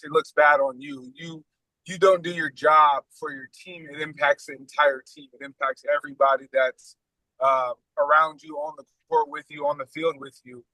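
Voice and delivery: ordinary delivery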